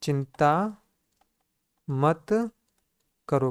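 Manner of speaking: slow, spaced dictation, a pause after each word. Shown as a demonstration. Teacher dictating to the class, slow and spaced. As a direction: say it word by word for students to write. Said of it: "तो क्या हो दोस्तों?".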